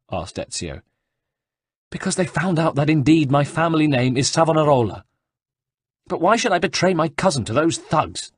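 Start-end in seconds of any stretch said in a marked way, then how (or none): 1.9-8.3 high, loud, panicky monk voice; frightened monk. Acted. Frightened monk tells a stranger about the soldiers, high and panicky. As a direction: rush it out like a scared friar.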